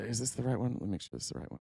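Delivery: quiet, trailing-off aside while checking; muttering to oneself. Real, not acted. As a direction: mumble it under your breath, half to yourself. Checking the clip, talking to self.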